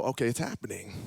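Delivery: low, soft, resigned murmur; hushed resignation. Retold or imitated. Joseph realising the lunch talk is turning deep, hushed. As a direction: drop the voice as if bracing for it.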